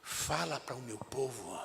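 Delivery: low, soft, solemn divine voice; voice of the Lord. Acted. Preacher voicing God softly to the congregation, hushed and solemn. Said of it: "O Senhor começou a falar assim comigo".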